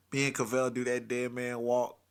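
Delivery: usual speaking voice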